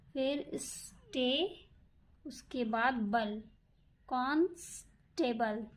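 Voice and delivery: syllables spaced and drawn out; patient teacher spelling; slow dictation. Shown as a demonstration. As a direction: build the word piece by piece. Teacher builds word stroke by stroke.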